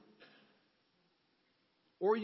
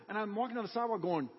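normal talking voice

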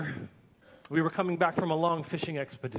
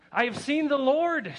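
much higher and louder, exultant; 9 semitones higher, 4 dB louder than usual. A narrator's reading. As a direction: raise it high in triumph. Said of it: "she said louder".

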